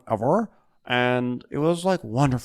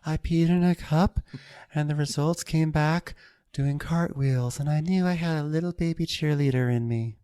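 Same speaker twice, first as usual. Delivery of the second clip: higher, perky mock chirp; mock perky Brandy; 6 semitones higher than usual. Imitating Brandy's bubbly pregnancy story, higher and perky. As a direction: chirp it like a cheerleader.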